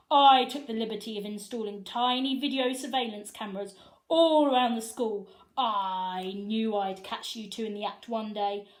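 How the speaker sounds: loud, gloating principal voice; triumphant principal. Acted. Principal revealing the cameras, loud gloating. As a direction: savour catching them at last.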